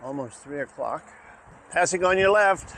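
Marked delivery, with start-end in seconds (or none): 1.7-2.7 loud high call to pedestrians; passing cyclist calling out. Real, not acted. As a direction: call it out as you ride past.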